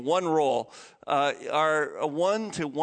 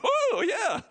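gleeful. Pretend. Imagining winning numbers.

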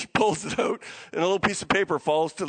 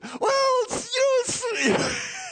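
high, squealing mock excitement; bursting with a secret; 14 semitones higher, much slower than usual. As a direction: act about to burst and spill it. Acted giver straining to keep secret.

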